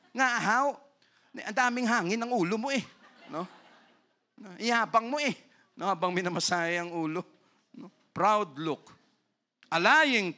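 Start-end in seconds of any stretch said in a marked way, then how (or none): 0.1-5.3 teasing teacher. Retold or imitated. Teacher teasing student in anecdote.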